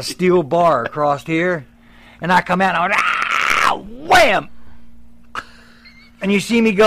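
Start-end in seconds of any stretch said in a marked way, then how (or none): 3.0-4.5 acted-out crash. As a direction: act out hitting the bar. Acts out his entrance crash.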